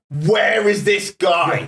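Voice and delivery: comic on stage hunting for the complainer, slowly; drawn-out mock search. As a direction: stretch each word as if scanning the room. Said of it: "spent ten minutes of my set going".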